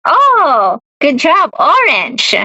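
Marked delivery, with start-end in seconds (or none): none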